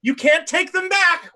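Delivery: high scolding sister voice; high, scolding reproach to the king; Sister Fodder berating. Acted. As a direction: berate him like a stern nun. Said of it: "she goes".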